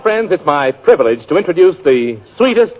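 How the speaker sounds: normal talking voice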